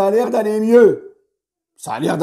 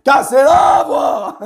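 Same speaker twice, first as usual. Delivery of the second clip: slow, higher, louder stretched shout; voice-cracking shout; 7 semitones higher, 4 dB louder, much slower than usual. Real, not acted. Fan mocking his own cracking voice, stretched shout. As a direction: shout it out though the voice breaks. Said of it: "ma voix, mais je m'en fous".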